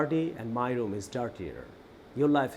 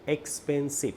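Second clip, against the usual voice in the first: syllable by syllable. Shown as a demonstration. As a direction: say expensive in three beats, ex, pen, sive. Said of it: "তিনটা syllable".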